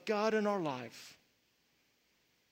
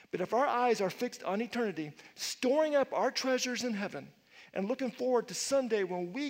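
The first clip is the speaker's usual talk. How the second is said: sermon climb, louder and higher; preacher building fervour; rising preaching; 3 semitones higher, 4 dB louder than usual. Acted. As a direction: press the point home to the congregation.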